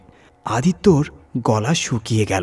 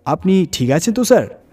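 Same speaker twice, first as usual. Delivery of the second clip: higher, surprised question; surprised concern; 8 semitones higher, 4 dB louder than usual. Acted. The hotel manager asks Aditya if he is fine, surprised. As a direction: ask as if startled by his state. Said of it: "manager বিস্মিত হয়ে জিজ্ঞাসা করল".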